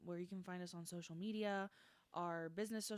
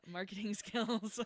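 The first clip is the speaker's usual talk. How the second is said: laughing through the words; 7 semitones higher, 7 dB louder, slower than usual. Real, not acted. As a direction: giggle it out. Laughing at own marketing.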